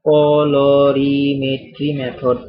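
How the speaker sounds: colorimetry method, slowly spelled out by syllable; slow dictation. Real, not acted. Teacher giving students the method to write down, slow dictation. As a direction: stretch it out for note-takers. Dictating the answer while writing it.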